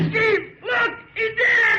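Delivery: helpless laughing yell; laughing shout. Acted. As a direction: shriek it through laughter. Laughing at bursting pants.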